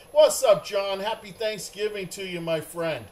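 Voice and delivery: loud, high hello to a friend; big cheerful shout-out; excited greeting. Real, not acted. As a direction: call out his name happily. Greeting friend in chat loudly.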